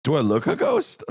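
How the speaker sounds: high, lightly voiced rising quote; a hint of the woman's voice; imitating the woman. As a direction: repeat the catchphrase in a mock voice. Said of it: "the whole trip we were saying".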